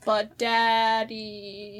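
very slow, drawn-out "But Daddy"; slow mock-whiny daughter; whiny child. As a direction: stretch it out like a pleading kid. Daughter role in reenactment.

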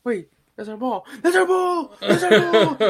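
high, loud mock yell with laughter breaking in; imitating a yell. LeBron claiming the ball, laughing imitated yell. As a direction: shout it, half laughing. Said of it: "he's yelling".

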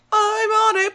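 a touch high, eager robot reply; lightly voiced Beryl. Host voices Beryl answering Megatron, lightly high. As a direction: chirp it back like an eager minion. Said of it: "And Beryl's like".

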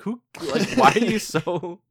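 high, loud imitated disbelief; mock bewildered reaction. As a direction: act out a baffled group response. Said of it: "everyone's like".

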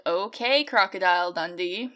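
a cheesy Crocodile Dundee Australian; mock Australian accent. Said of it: "offend all Australians in one go".

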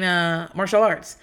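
normal talking voice

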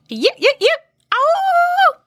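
high short yips into a long howl; imitated coyote call. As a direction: yelp three times, then howl. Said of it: "a yip and a howl".

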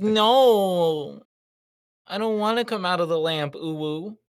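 high whiny genie voice; pouty genie, high and whiny; Genji. Retold or imitated. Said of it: "Genji's like".

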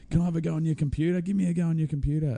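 high, quick mimicked begging; mock pestering punter. Pestering customer to bank clerk, mock high-pitched nagging.

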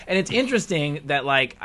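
ordinary talk

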